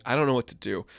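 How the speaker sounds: ordinary delivery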